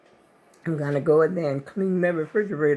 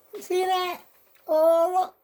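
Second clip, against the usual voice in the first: surprised; 11 semitones higher than usual. Real, not acted. Spots spill in fridge.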